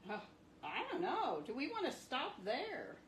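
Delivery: ordinary talk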